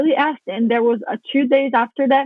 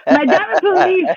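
laughing; 7 semitones higher, 4 dB louder than usual. Real, not acted.

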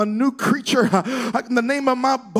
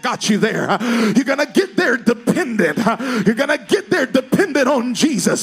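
fervent preaching; 2 semitones higher, 4 dB louder than usual. Real, not acted. Preaching climbs again to climax.

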